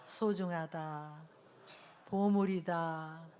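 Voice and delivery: very slow, deliberate phrases for the crowd to echo; slow call-and-repeat blessing; affirmations to repeat. Shown as a demonstration. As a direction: say each word slowly so they can follow. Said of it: "저를 따라해볼까요?".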